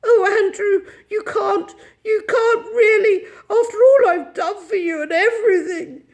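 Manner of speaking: high, tearful wail; hammed-up tearful reproach; nearly weeping. A narrator's reading. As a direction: plead on the verge of tears. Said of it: "was nearly weeping".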